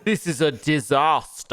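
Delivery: gruff action-hero voice; Duke Nukem voice. Pretend. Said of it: "my perfect Duke Nukem impression".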